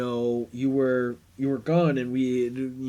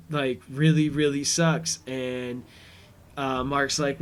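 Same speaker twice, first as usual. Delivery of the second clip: normal talking voice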